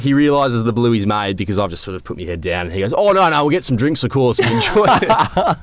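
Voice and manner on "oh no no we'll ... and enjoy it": high, laughing mock panic, slowing near the end; imitating a mate who is suddenly keen, laughing; laughing backpedal. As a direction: play the mate scrambling to fix it, laughing through it.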